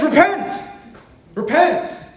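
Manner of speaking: high-pitched, drawn-out cry of exhortation; exclaimed command. Acted. Preacher acting out Peter's call to the crowd, high emphatic cry.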